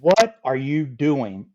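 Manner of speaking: drawn out slowly, each word weighed; slow it right down, a dumbfounded repeat; slow stern repeat. Retold or imitated. Jack repeating his question to Scott, slow and dumbfounded.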